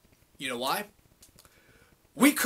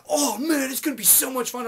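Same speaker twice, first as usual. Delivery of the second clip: mock enthusiastic churchgoer; 5 semitones higher than usual. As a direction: parody the peppy recruiter. Mimicking the Young Life guys.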